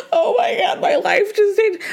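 high, crying-laughing gush; mock happy crying. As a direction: sob out pure joy. Mock joyful tears.

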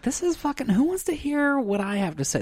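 high mock complaining voice; mock whine. Mocking own podcast self.

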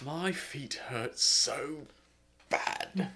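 dragged out very slowly, fading into a voiceless last word; worn-out, disgruntled Englishman; exhausted Englishman. Acted. Joking about a film character's sore feet, exhausted and drawn out. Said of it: "He's just a little exhausted".

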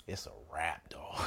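hushed, breathy disbelief; awed whisper. Real, not acted. Telling friends meeting the director, awed whisper. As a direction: whisper it like it's all over.

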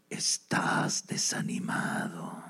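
very slow, low sinister whisper; hushed, drawn-out tempter; demon whisper. Acted. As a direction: whisper it slowly into his ear. Demon whispers on man's shoulder.

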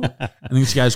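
normal talking voice

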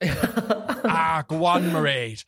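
hammed-up Irish brogue with a laugh; put-on stage Irish. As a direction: coax her in a mock Irish accent. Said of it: "That's the accent we put on".

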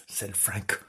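plain reading voice

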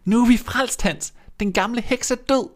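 put-on overjoyed child voice; jubilant. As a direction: cheer the witch's death. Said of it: "og jublede".

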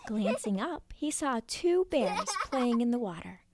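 ordinary delivery

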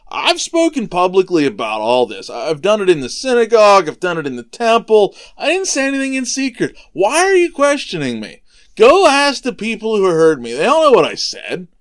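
protesting defense. Retold or imitated. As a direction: act out Jesus challenging his questioner. Jesus answers back to Annas.

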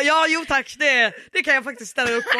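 loud, laughing, eager reply; laughing acceptance. Retold or imitated. Accepting the offer to go home, laughing loudly. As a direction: laugh while accepting gratefully. She gladly accepts leaving.

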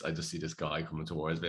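ordinary delivery

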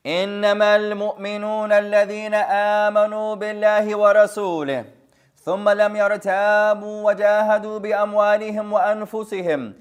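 measured, ringing recitation of the verse; Quran recitation. Shown as a demonstration. Teacher reciting a verse to his students, chanted. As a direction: recite the ayah in full tajweed.

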